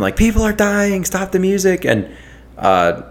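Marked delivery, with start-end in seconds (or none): 0.2-1.9 mock scream. Concertgoers screaming at the performer to stop, mock high-pitched scream. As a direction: imitate a panicked crowd's cry at talking volume. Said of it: "there were people that were screaming".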